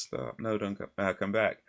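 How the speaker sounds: softly imitated token protest; mock warning with no urgency; half-hearted, throwaway objection; nonchalant. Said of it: "his response was just".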